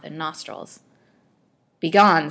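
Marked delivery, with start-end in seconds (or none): 1.8-2.3 louder, curt dismissal; sharp command. A narrator's reading. Physician ordering the old woman away, sharp and loud. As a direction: snap her away from the bed. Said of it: "said the physician sharply".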